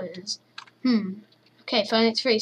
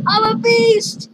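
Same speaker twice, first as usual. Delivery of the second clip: triumphant shout; 8 semitones higher than usual. Real, not acted.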